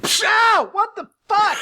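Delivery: high, loud comic yell; outraged outburst. Acted. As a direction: blurt it out in high-pitched outrage. Outraged outburst in acted scene.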